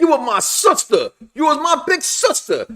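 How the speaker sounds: high-pitched, heartfelt mock plea; hammed-up high-voiced pleading; mock impassioned brother. Acting out brother's hurt plea.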